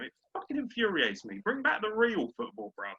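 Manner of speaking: ordinary talk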